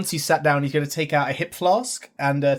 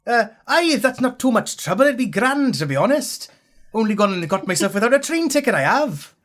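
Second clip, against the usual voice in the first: high, lilting put-on Welsh accent; 6 semitones higher than usual. Old Welsh hitchhiker asking the driver, high Welsh accent. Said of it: "I'm picking up your accent".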